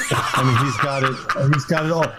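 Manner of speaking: ordinary delivery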